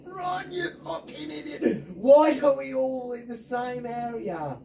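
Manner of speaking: high laughing yell at a useless teammate; half laughing, half screaming; frustrated yell. Real, not acted. Yelling at teammate in game.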